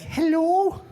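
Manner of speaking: high, loud, lilting phone hello; shrieky-high Scottish matron voice; Missus Doubtfire. Retold or imitated. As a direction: imitate a Scottish mom answering the phone. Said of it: "my mom sounds like Missus Doubtfire".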